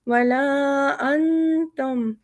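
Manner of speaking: अंतुम with a held नून then ता; weighted noon. Shown as a demonstration. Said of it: "नून पे हम वज़न देके ता की आवाज़ निकालेंगे". (नून पर वज़न देकर पढ़ें)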